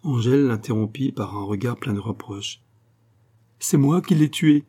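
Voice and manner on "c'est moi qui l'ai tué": higher, louder anguished outcry; exalted cry. A narrator's reading. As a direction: cry it out in guilty despair. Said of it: "s'écria-t-elle avec exaltation".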